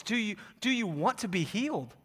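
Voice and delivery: hushed appeal; soft, searching question. Real, not acted. Question that remains for all of us, hushed appeal. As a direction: ask it softly, letting it sink in. Closing question put softly to church.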